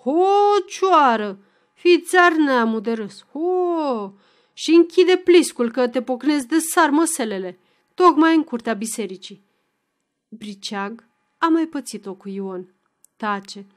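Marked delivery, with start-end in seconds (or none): 0.0-9.4 loud, hot-tempered threat; angry outburst. A narrator's reading. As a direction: flare up like fire at him. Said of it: "izbucnește răgușit".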